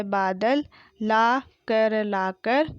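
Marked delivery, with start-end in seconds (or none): 1.0-2.0 ला कर broken into laa, ka, ra; slow ला कर, each letter separate; spelled out. Shown as a demonstration. Teacher sounding out ला कर for pupils, syllable by syllable. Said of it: "मैंने इस तरीके से पढ़ा".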